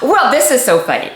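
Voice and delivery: ordinary talk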